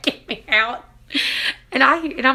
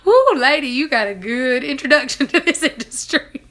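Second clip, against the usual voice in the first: high, loud teasing through laughter; laughing tease; 5 semitones higher, 6 dB louder than usual. Real, not acted. Patient teasing the crying nurse, laughing loudly. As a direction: rib her kindly while laughing.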